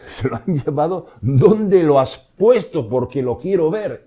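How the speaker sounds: Mary pleading. Acted. Mary at the tomb pleading with the gardener, anguished cry. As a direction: cry out desperately for him. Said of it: "Y lo dice".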